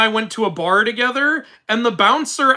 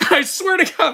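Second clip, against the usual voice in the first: laughing; 9 semitones higher than usual. Real, not acted. Still laughing from the story.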